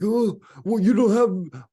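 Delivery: high, loud snooty whine; mocking people who judge by likes, high sneering whine. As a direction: parody a shallow know-it-all. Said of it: "I'm like".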